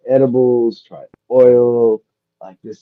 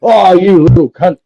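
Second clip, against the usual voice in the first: angry butcher; 8 semitones higher, 10 dB louder than usual. Acted. Joke, butcher insults kid.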